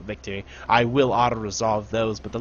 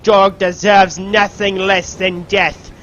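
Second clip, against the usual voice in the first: loud, raised theatrical order; commander's condemnation; 5 semitones higher, 6 dB louder than usual. Pretend. Commander condemning a fleeing traitor, loud hammed-up command. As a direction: pronounce the sentence loudly, slowing down.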